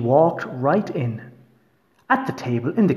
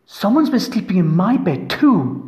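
higher-pitched mother bear voice; Mama Bear; 6 semitones higher, 4 dB louder than usual. A narrator's reading. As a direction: play an indignant storybook mother bear, pitched up. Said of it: "said the Mama Bear".

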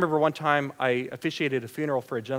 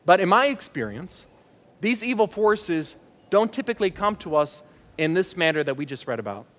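ordinary talk, nothing marked

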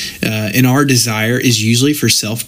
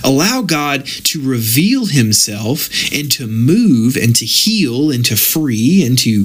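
ordinary talk, nothing marked

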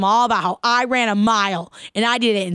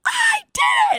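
excited shout. Retold or imitated. Girl finishing the mile shouting in triumph. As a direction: yell it like you just won. Kid celebrating finishing the run.

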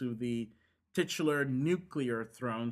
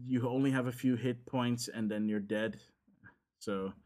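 normal talking voice

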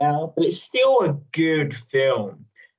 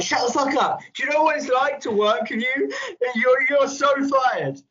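mock furious rant; 9 semitones higher than usual. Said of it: "he'd be like".

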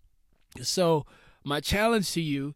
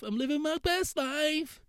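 very high put-on show-off voice; high-pitched parody of self-satisfied posting; mock bragging; 12 semitones higher than usual. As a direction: squeal it like a mock online boaster. Mocking online good-life boasts.

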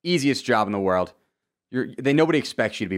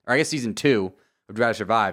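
normal talking voice

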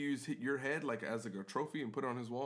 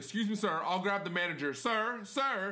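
loud, raised voice to get through; urgent calling; 7 semitones higher, 4 dB louder than usual. Retold or imitated. Bartender trying to stop the raging customer, insistent calling. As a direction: call out insistently over an angry man.

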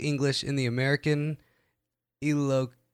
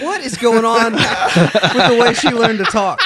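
laughing disbelief; 8 semitones higher, 9 dB louder, slower than usual. Retold or imitated. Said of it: "And I was like".

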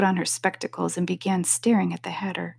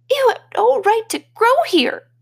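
squeaky Dormouse; 5 dB louder than usual. A narrator's reading. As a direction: squeak it out like a tiny mouse. Dormouse voiced in story.

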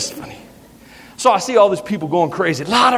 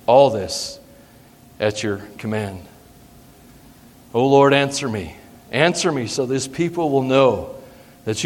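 lower, softer, measured prayer with long pauses; solemn prayer; 8 semitones lower, 3 dB quieter than usual. Acted. Elijah praying to God at the altar, solemn and hushed.

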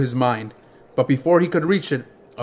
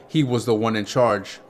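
plain reading voice

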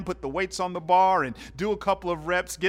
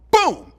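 shouted boom imitating the bar; loud boom; 14 semitones higher, 12 dB louder than usual. Preacher imitates the bar slamming down, shouted boom. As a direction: belt out the thud of the weights. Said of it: "sound of weight dropping".

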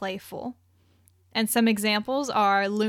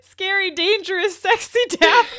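high, loud, laughing mock-sultry delivery; laughing mock drama; 9 semitones higher, 6 dB louder than usual. Real, not acted. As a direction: laugh through it, hamming up the sexy label. Mocking the sexy Daphne description.

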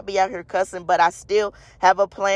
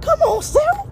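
high, strained self-scolding. Retold or imitated. Preacher mocking his own inner voice, high and scolding. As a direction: berate yourself in a high pitched voice. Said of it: "it's like".

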